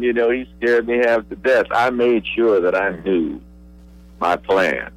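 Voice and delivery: usual speaking voice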